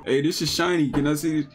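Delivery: ordinary talk, nothing marked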